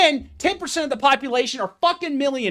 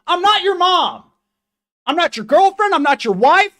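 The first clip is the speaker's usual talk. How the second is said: angry shout; 5 semitones higher, 4 dB louder than usual. Real, not acted. Angry rant at excusing viewers.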